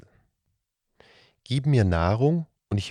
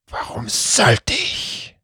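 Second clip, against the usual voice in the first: slow, loud hiss of a suspicious beast; hissing creature; 8 semitones higher, 7 dB louder, slower than usual. Acted. The cat-headed serpent refusing the children, slow loud hiss. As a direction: hiss it back, slowly, as the wary creature. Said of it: "fauchte der Datzelwurm".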